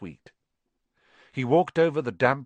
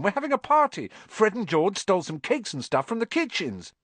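put-on excited boy; 9 semitones higher, 3 dB louder than usual. A narrator's reading. Ron's excited news.

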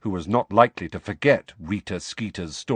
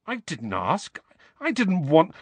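put-on rising, broken-off boy's voice; Harry defending himself; Harry, protesting; 11 semitones higher than usual. A narrator's reading. Harry protests to Hermione, breaking off.

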